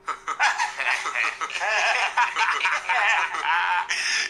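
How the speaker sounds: high villainous cackle; maniacal laugh. Acted. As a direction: cackle like a madman.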